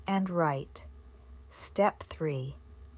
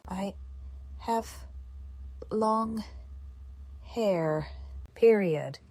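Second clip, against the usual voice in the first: dictating. Shown as a demonstration. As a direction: spell it out for the class. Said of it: "I repeat".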